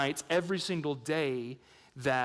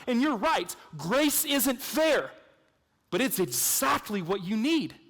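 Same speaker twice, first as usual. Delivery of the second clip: ordinary talk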